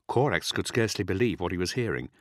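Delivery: ordinary delivery, nothing marked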